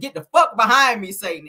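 retold rebuke, loud at the start; mock testifying. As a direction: punch the curse, then drop on Satan. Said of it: "He got up there testifying".

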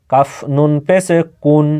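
ordinary talk

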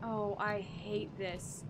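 low, slow muttered dread. Real, not acted. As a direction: groan it under your breath. Dreading the scary section.

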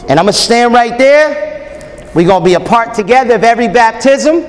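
normal talking voice

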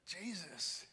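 a grieving father begging why you were late; anguished plea. Retold or imitated. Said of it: "He's thinking".

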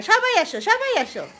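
high, loud repeated summons; calling the flock. Real, not acted. Owner calling all her pets to come to the food, loud. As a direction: call them all over across the room.

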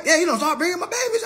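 ordinary talk

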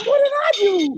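high-pitched bewildered yelp; startled protest. Retold or imitated. Host replaying his phone reaction to friend's rage, high startled protest. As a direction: squeal it like someone wrongly yelled at. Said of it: "But anyway, I'm like".

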